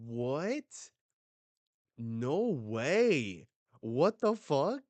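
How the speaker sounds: high, loud astonished outburst; shocked disbelief. Real, not acted. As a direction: react in real shock. Shocked reaction to the scene.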